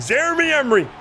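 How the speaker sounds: high, loud excited shout of the name; excited yell. Real, not acted. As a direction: call the name out like a big play just happened. Excited shout at a big play.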